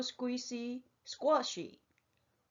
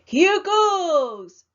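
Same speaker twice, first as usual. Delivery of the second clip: much higher, louder storybook yell; 7 semitones higher, 9 dB louder than usual. A narrator's reading. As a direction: shout it as he jumps in. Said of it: "He held his nose and yelled".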